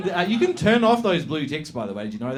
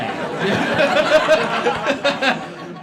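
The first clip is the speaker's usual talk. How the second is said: loud high burst of laughter; big laugh; 11 semitones higher, 5 dB louder than usual. Real, not acted. Host laughing hard at the audience's one-word answer. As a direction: laugh out loud at the mumbled yeah.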